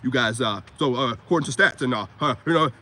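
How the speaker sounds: low, forced deep voice pushed from the throat; mock a podcaster faking a deep voice; fake deep throat voice. Mocking podcast guys, fake deep throaty voice. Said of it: "This is how y'all be sounding".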